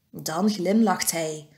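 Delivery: plain reading voice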